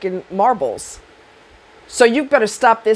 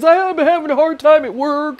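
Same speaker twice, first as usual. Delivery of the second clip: mock whiny excuse; 6 semitones higher than usual. Mocking an abuser's excuse.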